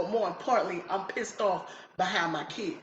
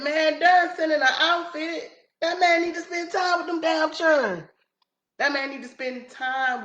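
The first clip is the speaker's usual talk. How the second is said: exasperated rant; 8 semitones higher, 4 dB louder than usual. Real, not acted. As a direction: vent at the deadbeat dad, loud and high. Venting about the absent father.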